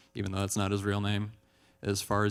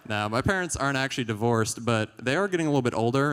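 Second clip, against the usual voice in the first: normal talking voice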